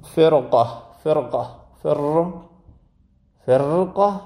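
فرقه with the ر made heavy before the ق; فر split off and stressed, ر thick. Shown as a demonstration. Teacher breaking down فرقه for students. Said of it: "سخته".

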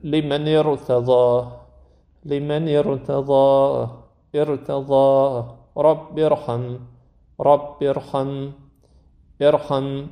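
ارتضی and ارحم with the ر thick and heavy, slowly; slow recitation, ر after alif wasl made heavy. Shown as a demonstration. Quran teacher modeling the heavy R after alif wasl. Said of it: "رایی که الف وصل داره".

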